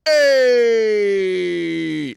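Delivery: loud, high wise guy hey; mock Jersey greeting yell; Mike the Situation. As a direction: shout it like a Jersey tough guy.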